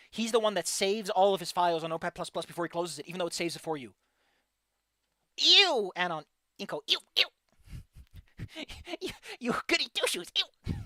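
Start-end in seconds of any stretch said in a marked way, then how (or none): 5.4-10.7 mock disgust. Teasing friend as goody two-shoes.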